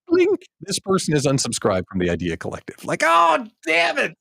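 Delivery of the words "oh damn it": squeaky high dismayed cry; a drawn-out high groan of defeat; reading the unsubscribe notice in dismay; mock dismay. Reacting to the unsubscribe.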